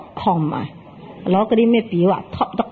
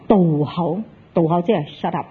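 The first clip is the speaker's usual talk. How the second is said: ordinary talk, nothing marked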